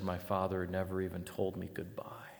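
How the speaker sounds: soft, voiceless, grieving whisper; whispered grief. Retold or imitated. Preacher retelling a grieving daughter's words. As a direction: let the words barely escape through grief. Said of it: "wept for ten minutes as she said".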